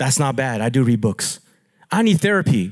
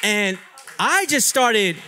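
usual speaking voice

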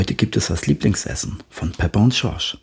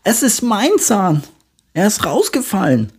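put-on a little girl amazed at her tooth; excited little piglet; 13 semitones higher, 5 dB louder than usual. A narrator's reading. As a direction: Pepper tells Mama her tooth fell out, high and loud. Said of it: "ruft Pepper".

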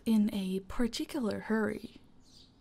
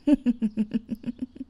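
a short, louder laugh; loud amused laugh; 7 dB louder than usual. Acted. Laughing at the guest's flustered reaction. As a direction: laugh out, delighted.

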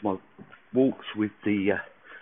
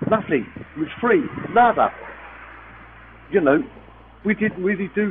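high, loud and delighted; excited; 7 semitones higher, 4 dB louder than usual. Real, not acted. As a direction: gush with real relief. Relieved, treatment free.

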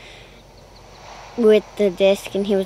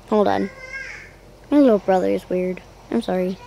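normal talking voice

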